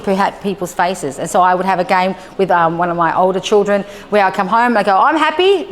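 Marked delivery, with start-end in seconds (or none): 5.0-5.7 high, sing-out happy greeting; acted happiness. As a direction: show a child exaggerated happiness. Acting happy in face game.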